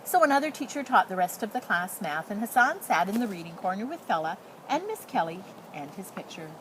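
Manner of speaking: ordinary delivery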